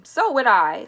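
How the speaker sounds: loud, forceful call of agreement; Grandma Georgina calling out. A narrator's reading. Second grandma backing up the first, calling out loudly. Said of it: "called Grandma Georgina".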